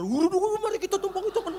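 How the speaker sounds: high excited mock helper; high mock eager facilitator. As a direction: hurry in like an eager assistant. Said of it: "yang fasilitator".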